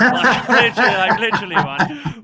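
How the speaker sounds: loud, laughing through the words. Real, not acted. Host laughing at his love-hate for Ruben, laughing loudly. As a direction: burst out laughing as you repeat it.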